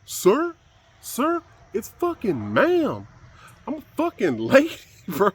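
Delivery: high, loud, slow put-on woman's voice; mocking falsetto outrage, laughing at the end; mock falsetto lady. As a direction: shriek it in a hammed-up lady voice. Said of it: "he went".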